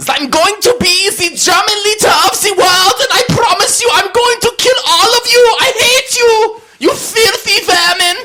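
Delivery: screamed high-pitched German tyrant rant; German dictator accent. Pretend. Host showing off his best accent, shouted German dictator. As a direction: bellow it like a ranting German leader. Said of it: "what's the best accent you can do".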